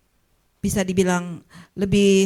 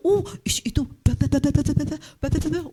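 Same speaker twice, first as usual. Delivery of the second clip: mock gossip mutter; 6 semitones higher than usual.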